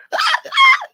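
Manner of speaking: very high shriek of laughter. Real, not acted.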